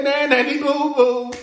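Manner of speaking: lightly voiced teasing, sing-song; a touch of mock childish teasing; playful taunt. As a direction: brush it off with a playful tease. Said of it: "you should have just went on and said".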